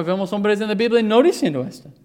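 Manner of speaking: ordinary delivery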